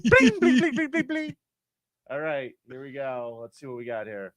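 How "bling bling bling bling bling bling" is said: very high sung bling noises, rising into a squeal; pinball bling sounds. Pretend. Host imitating a pinball machine, high mock chimes.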